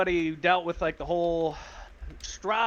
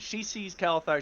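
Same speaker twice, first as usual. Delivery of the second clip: ordinary talk, nothing marked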